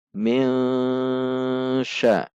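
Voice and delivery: "Min" berdengung panjang, samar, lalu "sya"; ikhfa samar. Shown as a demonstration. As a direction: tahan dengung "Min" di posisi shin. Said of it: "Sudah pada titik shin, siap-siap". (n samar ditahan sebelum "sya")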